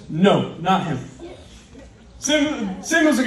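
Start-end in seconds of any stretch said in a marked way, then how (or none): 0.1-1.0 low, firm refusal as God; deep-voiced divine no. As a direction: drop the voice for God's verdict. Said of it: "God speaks to him and says".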